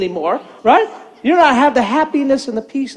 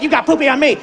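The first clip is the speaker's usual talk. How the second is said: grandpa arguing back in his own register; mild mock-indignant comeback; grandpa's retort; faster than usual. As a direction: retort to a child, close to your normal voice. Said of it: "and I'm like".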